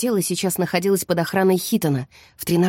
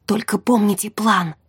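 ordinary delivery, nothing marked

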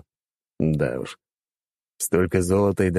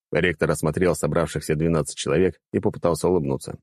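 ordinary delivery

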